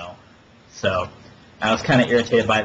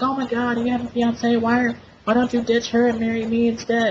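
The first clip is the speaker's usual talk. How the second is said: put-on high, squeaky, overexcited girly voice; mock gushing stripper; 11 semitones higher than usual. Mocking the stripper urging Derek to ditch his fiance, high and gushing. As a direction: gush it like a ditzy stripper.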